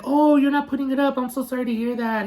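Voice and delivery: high, quick mock sympathy; sarcastic mimicry. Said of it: "so upset with his sarcasm".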